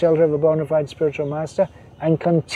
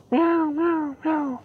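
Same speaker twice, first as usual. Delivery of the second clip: high soft contented meowing; kitten meow; 11 semitones higher, 7 dB quieter, much slower than usual. Retold or imitated. Preacher imitating the comfortable kitten, soft high meows. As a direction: mew softly like a content kitten. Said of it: "the cub is feeling very comfortable".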